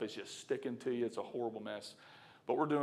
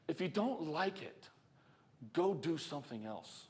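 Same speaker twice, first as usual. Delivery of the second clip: normal talking voice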